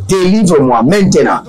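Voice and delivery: ordinary delivery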